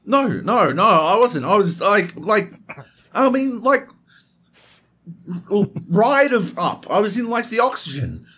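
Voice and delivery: flustered Heath imitation. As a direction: stall and fumble for an alibi.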